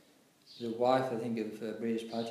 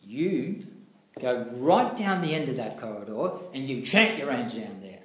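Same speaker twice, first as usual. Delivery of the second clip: loud scolding command; angry order; 7 dB louder than usual. Retold or imitated. Guru ordering the disciple away, loud and stern. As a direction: send him off down the corridor sternly. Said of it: "He comes out".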